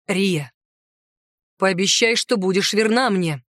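a hint of a man, slightly low; lightly voiced man. A narrator's reading. Centaur asking Riya to be faithful, lightly voiced low register.

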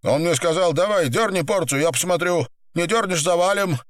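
high, urgent pleading voice; pinned gangster, pleading. Acted. Pinned man explains himself to boss, high urgent pleading. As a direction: plead your case in a high voice.

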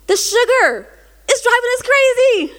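high, loud hammed-up outburst; mock hysterical. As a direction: cry out in exaggerated exasperation. Acting out her tearful sugar rant.

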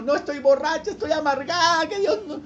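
high-pitched mock wailing; bitter, sad woman. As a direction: protest in an acted tearful whine. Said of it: "estoy muy amargada, estoy muy triste".

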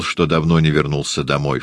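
usual speaking voice

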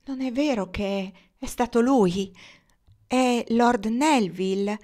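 narrated cry of hope, loud and high; cried out. A narrator's reading. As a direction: call out anxiously, pressing for the name. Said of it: "gridò".

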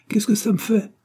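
put-on a boy talking back rudely; rude retort. A narrator's reading. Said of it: "répondit grossièrement Georges". (Georges répond grossièrement à l'institutrice, voix très aiguë)

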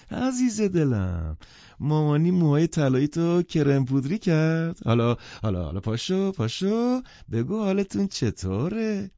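put-on high, gushing call to a child; doting mother. A narrator's reading. Mother opens her arms to her little girl, gushing. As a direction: coo it loudly, stretching the endearment.

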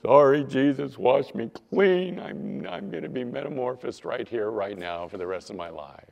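hammed-up pleading kid's repentance; mock-penitent child. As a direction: plead with exaggerated contrition, drawing it out. They get on their knees.

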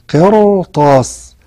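قرطاس drawn out very slowly, R thick and heavy; slow recitation-style قرطاس; heavy R demo. Shown as a demonstration. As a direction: stretch قرطاس with a full heavy R. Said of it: "ففخمت".

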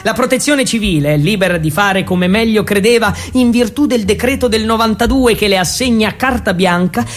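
ordinary talk, nothing marked

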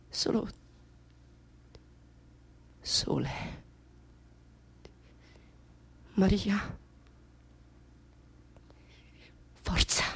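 halting whisper, word by word; faint and spent. Acted. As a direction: barely get the words out. Faltering, urging herself on. (sussurro sfinito, spezzato)

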